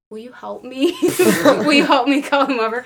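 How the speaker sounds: hammed-up helpless fan plea; desperate plea. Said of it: "desperately look at Simon".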